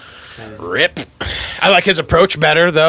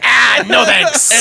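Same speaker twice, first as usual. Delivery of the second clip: disgusted refusal; 8 semitones higher, 3 dB louder, much slower than usual. Retold or imitated.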